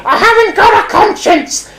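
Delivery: very high squeaky puppet cry; Punch squawk. Acted. Punch boasting to the audience, very high squawk.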